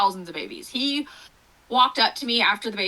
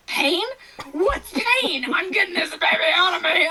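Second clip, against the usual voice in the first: higher, louder scoffing at pain; defiant mock bravado; 7 semitones higher, 4 dB louder than usual. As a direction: toss off pain like it's nothing. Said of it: "I just was like".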